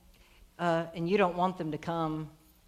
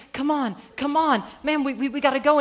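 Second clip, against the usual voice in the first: higher, louder urgent pleading; Jairus; 4 semitones higher, 4 dB louder than usual. Acted. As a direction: impatiently hurry someone along. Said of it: "And Jairus is saying".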